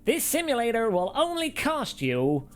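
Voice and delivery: high, slow, hammed-up American accent; a terrible American accent imitation of Sean Cole; bad American accent. Mocking a fellow reviewer in a bad American accent. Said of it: "That's the best American accent I can do".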